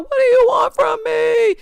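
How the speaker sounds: high, loud mock wail; hammed-up cry of despair, high and loud; mock desperate wail. Mimicking a woman fallen to the ground, mock desperate wail. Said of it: "when you fall down to the ground talking about".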